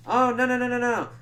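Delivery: high, loud string of protests; hasty mock denial. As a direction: blurt out an exaggerated denial. Acted hasty denial by characters.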